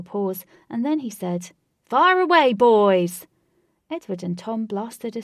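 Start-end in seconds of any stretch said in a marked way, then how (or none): 1.9-3.3 very loud, much higher call; host yelling to his elephants to spray; shouted command. A narrator's reading. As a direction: bellow the order to fire.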